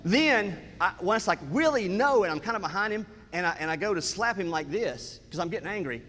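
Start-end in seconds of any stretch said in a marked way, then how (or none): 1.5-2.2 higher-pitched retold scolding; scolding the dog. Owner behind the misbehaving dog, losing patience, scolding.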